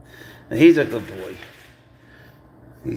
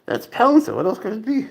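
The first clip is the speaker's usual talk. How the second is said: much higher, excited exclamation; 8 semitones higher than usual. Real, not acted. Owner proudly naming his cat, high and excited. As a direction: announce the cat with delight.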